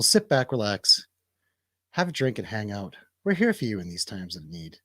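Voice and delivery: usual speaking voice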